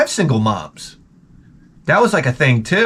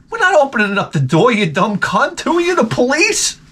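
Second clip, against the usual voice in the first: higher, fast, taunting; mocking retort; 8 semitones higher than usual. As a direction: sneer it back through the closed door. Kids yelling back through door.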